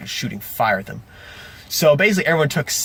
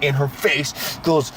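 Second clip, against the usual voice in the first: usual speaking voice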